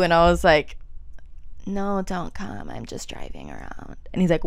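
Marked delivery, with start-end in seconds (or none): 1.7-4.0 evasive. Retold or imitated. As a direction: put him off quietly, trailing down. Putting him off on retold call.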